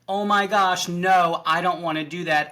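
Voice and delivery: high, loud panicked protest; mock panic. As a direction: imitate a sudden freak-out, hammed up. Imitating her sudden refusal to jump.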